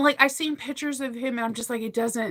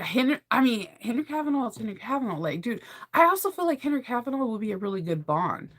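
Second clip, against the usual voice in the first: ordinary talk, nothing marked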